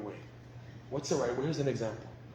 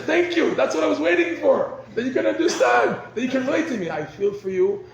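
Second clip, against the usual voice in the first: much higher and louder, gushing thanks; grateful wife; 10 semitones higher, 8 dB louder than usual. Retold or imitated. Wife thanks husband for understanding her, high and gushing. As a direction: gush with relief like a grateful wife.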